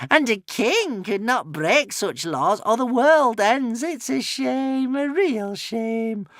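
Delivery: high, slow witch voice gloating. A narrator's reading. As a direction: savour each word like a cruel witch. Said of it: "gloated the witch".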